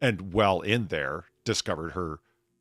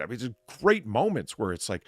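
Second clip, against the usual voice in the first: ordinary delivery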